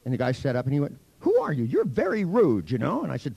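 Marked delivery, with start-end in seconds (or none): 1.2-3.0 high, louder, affronted protest; indignant patient. Retold or imitated. Patient sitting up to challenge a rude therapist, indignant. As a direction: imitate an offended man, pitch jumping up.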